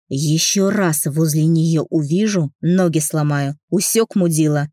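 usual speaking voice

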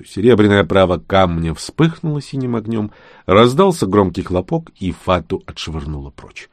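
ordinary delivery, nothing marked

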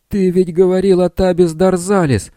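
high, loud accusing shout. A narrator's reading. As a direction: yell it at him in outrage. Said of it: "закричал Билл".

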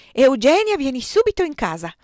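loud, high, urgent order; commanding. A narrator's reading. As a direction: order your sister indoors at once. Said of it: "comandò Stefano". (ordine secco, gridato)

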